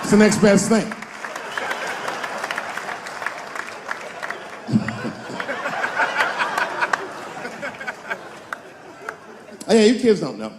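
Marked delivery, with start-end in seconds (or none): 1.0-8.3 big crowd laughing; crowd laughter. Real, not acted. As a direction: audience roars with laughter. Audience laughs at joke.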